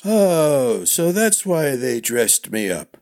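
put-on high, slow, creaky old master, puzzled; a forgetful old knight squinting; doddering old man. A narrator's reading. Old master Crushmore voiced.

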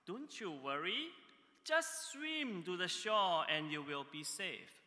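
loud reply. Retold or imitated. Friend on the shore to drowning man, loud reply. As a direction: call out reassurance across the water. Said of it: "his friends on the shore replied loudly".

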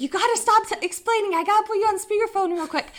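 high, excited friend's voice; urgent interruption. Retold or imitated. Imitating friend who wants others to hear, urgent.

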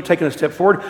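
ordinary talk, nothing marked

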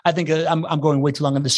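usual speaking voice

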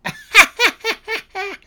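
high hammed-up villain laugh; Joker cackle. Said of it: "Joker here".

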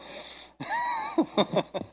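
high wordless laugh. Real, not acted. Preacher joking about his inherited grumbling, laughing. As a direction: break into a high chuckle.

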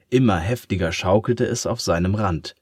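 usual speaking voice

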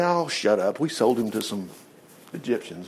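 dismissive brush-off. Acted. Brothers brushing off Reuben.

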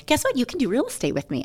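high, fast, eager sister; Heather impression. Sister at the door pitching real estate, high and eager. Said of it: "That was such a good impression".

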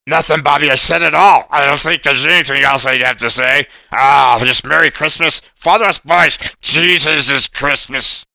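lightly voiced cheery puppet; a hint of a cartoon puppet wishing Merry Christmas; bright puppet sign-off; Oscar puppet voice.

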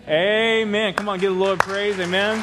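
loud, high-pitched rallying amen; preacher's lifted, projecting shout of praise; rousing call. Real, not acted. Preacher rallies congregation to respond.

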